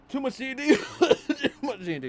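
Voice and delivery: high, loud laughing admission; laughing self-mockery. Real, not acted. Player mocking own fantasy habit, laughing loudly. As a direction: break up laughing at yourself.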